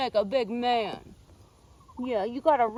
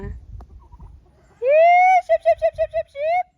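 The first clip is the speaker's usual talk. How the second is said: very high, rhythmic calling chant; sheep call; 9 dB louder, much slower than usual. Real, not acted. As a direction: summon the flock with quick repeated high calls. Calling the flock in.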